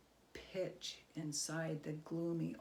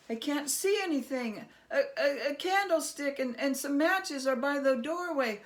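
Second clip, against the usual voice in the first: much higher, louder, stumbling words; nervous stammering Herschel; 7 semitones higher, 8 dB louder than usual. Acted. Herschel stammering, pretending the dark is the problem. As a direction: fake bravado over fear. Herschel hides his fear.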